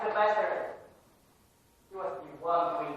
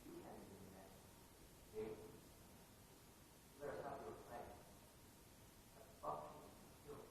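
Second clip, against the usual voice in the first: bitter whisper; 9 semitones lower, 23 dB quieter than usual. Acted. Student after the outburst, a bitter hushed whisper. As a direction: drop to a cold near-whisper.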